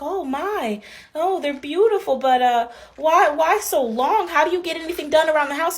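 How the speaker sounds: lightly voiced nosy grandmother; mock old lady. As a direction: play the fussy grandma. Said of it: "she's like".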